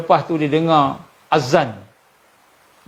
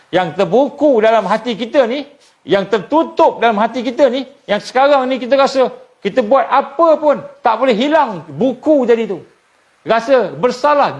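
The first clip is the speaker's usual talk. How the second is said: higher, intense sermon build; fervent preaching; 7 semitones higher than usual. Real, not acted. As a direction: press the guilt home with rising fervour. Preacher rises in fervour. (ceramah bersemangat, nada tinggi)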